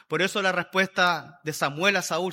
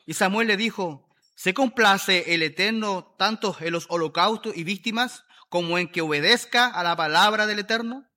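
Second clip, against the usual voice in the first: louder, proclaiming Bible reading; scripture reading; 5 dB louder than usual. Acted. Preacher reading the Bible to his congregation, proclaiming. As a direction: read the verses out like a proclamation.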